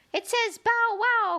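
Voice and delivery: high mock bark from a little daisy; daisy crying out. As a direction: squeal it out like a tiny excited daisy. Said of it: "cried a daisy".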